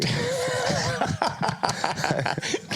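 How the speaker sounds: shrieking laugh, very high; high squealing laugh. Real, not acted. Host caught out on camera, high squeal of a laugh.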